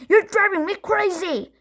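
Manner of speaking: dad at the end of his tether in the car; screaming. A narrator's reading. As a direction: lose patience with the kid. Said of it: "screamed Dad".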